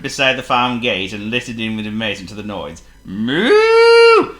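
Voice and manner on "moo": high, loud mooing cow. A narrator's reading. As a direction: moo like a cow in the yard. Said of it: "moved the cows".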